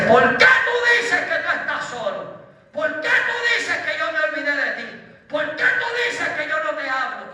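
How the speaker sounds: high, loud challenging questions; forceful rebuke. Retold or imitated. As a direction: confront the doubter with ringing, insistent questions. Acted rebuke voiced as God's message. (reproche imponente, en voz alta y aguda)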